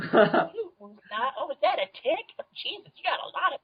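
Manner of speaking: very high cooing pet voice; playful cat bit. Pretend. Guest grooming the moose for ticks, playful cat bit. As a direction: fuss over the animal in a squeaky put-on pitch. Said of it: "do, like, the cat thing".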